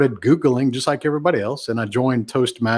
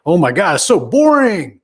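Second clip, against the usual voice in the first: higher, louder mock exasperation; exasperated groan; 6 semitones higher, 5 dB louder than usual. A bored Clubhouse listener complaining, loud and exasperated. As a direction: throw your hands up at the boredom. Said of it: "mocking bored listener".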